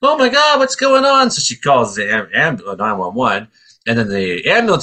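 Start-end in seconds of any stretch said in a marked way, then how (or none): 0.0-1.3 much higher, louder frantic cry; alarmed mom. Retold or imitated. Mom looking out the window, high frantic cry. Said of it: "she's like".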